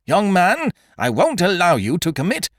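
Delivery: high, loud, commanding protest; stern outcry. A narrator's reading. Magistrate grabbing Beautrelet to stop him, loud outcry. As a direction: seize him and forbid it.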